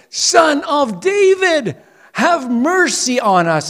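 high, slow, pleading call; crying out. A narrator's reading. Blind men calling to Jesus, a high slow plea. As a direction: cry out for mercy, drawn out. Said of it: "automatically right there said".

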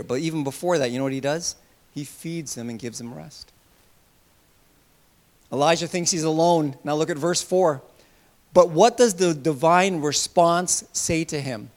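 ordinary talk, nothing marked

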